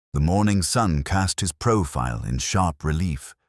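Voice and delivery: plain reading voice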